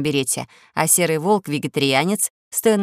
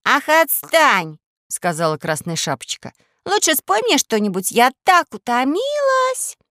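little princess; 7 semitones higher, 3 dB louder than usual. Acted. Princess whines.

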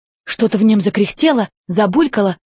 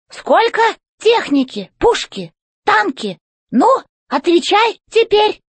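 high, slow, commanding robot boss; robot chef voice; 11 semitones higher than usual. A narrator's reading. Robot chef demanding answers from the old robot, high and commanding. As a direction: bark orders from up high like a tin general.

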